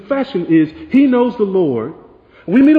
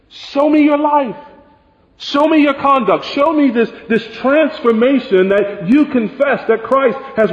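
demanding challenge; 6 semitones higher than usual. Retold or imitated. John demanding proof of life.

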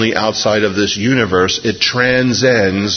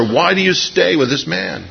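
bitter; 8 semitones higher than usual. Retold or imitated. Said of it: "just being bitter at their mother".

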